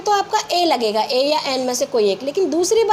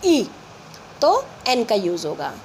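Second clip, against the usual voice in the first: usual speaking voice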